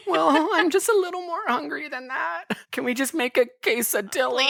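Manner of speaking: high, light mock Bella voice; a high put-on girlish voice asking for a snack. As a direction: pipe up like a hungry girl.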